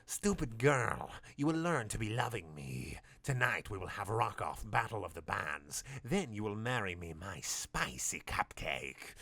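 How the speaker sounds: lightly voiced villain, slow and drawn out at the end; a hint of a smug villain. Villain courting the captive, lightly voiced smug tone.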